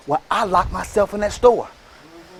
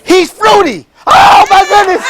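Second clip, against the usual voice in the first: loud, higher, rising preaching; preaching shout; 6 semitones higher, 6 dB louder than usual. Real, not acted. Quoting scripture to the crowd, a loud preaching shout. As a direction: preach it with full force.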